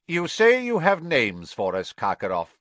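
lightly voiced stern magistrate; stern official. A narrator's reading. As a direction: press the prisoner firmly. Crouch questions the prisoner.

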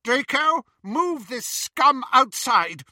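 high, loud slow command; imperious witch. Acted. Bellatrix to Draco, high loud slow command. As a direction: order him around like a cruel aunt. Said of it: "said Bellatrix".

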